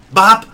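loud, high "Bop!" like a glove hitting; punch sound. Retold or imitated. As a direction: smack out the punch noise. Imitating the punch landing.

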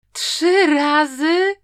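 loud, high, drawn-out incredulous question; stunned, stretched-out exclamation; appalled disbelief. A narrator's reading. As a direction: echo the punishment in slow outrage. Told to copy rules thrice.

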